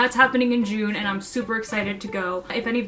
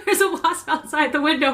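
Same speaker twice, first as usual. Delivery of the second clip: high, laughing exclamation; laughing relief; 6 semitones higher than usual. Real, not acted. Relieved the wasp is outside, laughing. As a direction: laugh off the scare.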